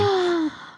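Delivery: sharp delighted gasp, high; excited gasp. Real, not acted.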